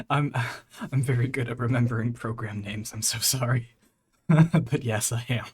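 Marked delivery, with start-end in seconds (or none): none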